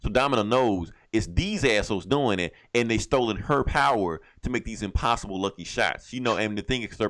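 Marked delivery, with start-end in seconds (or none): none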